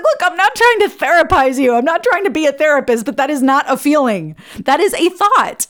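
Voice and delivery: emphatic retort. Retold or imitated. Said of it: "I was like".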